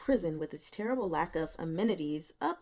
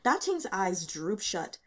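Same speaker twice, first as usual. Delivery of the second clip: plain reading voice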